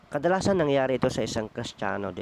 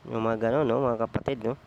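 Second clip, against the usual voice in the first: ordinary talk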